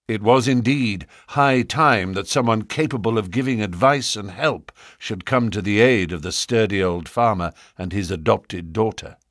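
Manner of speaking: ordinary delivery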